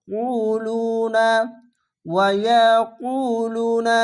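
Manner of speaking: ওয়া ইয়াকুলুনা chanted slowly with heavy কু; slow tajweed reading of ইয়াকুলুনা; Quranic recitation. Shown as a demonstration. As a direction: recite ইয়াকুলুনা long and round. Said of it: "তার জন্য".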